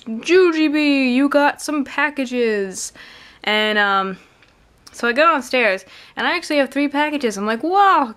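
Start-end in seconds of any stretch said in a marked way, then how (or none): 0.0-2.9 imitated dad's high, slow call. Retelling dad calling her by nickname. 7.6-8.1 high, loud mock-amazed wow; impressed exclamation. Retold delight at three packages.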